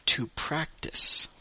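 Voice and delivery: to practice, slow and clear; to practice, slow teacher model. Shown as a demonstration. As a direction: drag out to practice for learners. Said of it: "deux fois en anglais".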